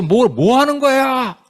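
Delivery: high, drawn-out exasperated call; impatient shout. Retold or imitated. People behind Moses demanding what he's doing, high impatient shout.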